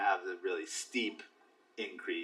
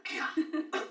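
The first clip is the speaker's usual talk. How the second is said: exasperated. Retold or imitated. Exasperated reaction to an evaluation.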